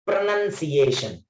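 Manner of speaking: pronunciation drawn out, with "nun" in the second syllable; actual pronunciation. Shown as a demonstration. Teacher giving the actual word, pronunciation slowly said with "nun". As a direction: say pronunciation slowly as "pro-nun-see-AY-shun". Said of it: "That is the actual".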